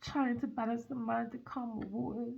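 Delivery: hushed, close comforting read; soft intimate reading. Real, not acted. Tarot reader soothing the viewer, hushed. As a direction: speak softly to the listener.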